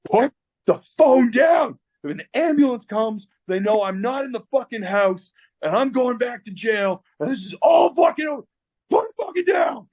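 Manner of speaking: high, desperate mock screaming; enraged boss. Stricken boss forbids apprentice to call an ambulance, enraged screaming. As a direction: imitate a frantic man shouting orders, peaking at the end.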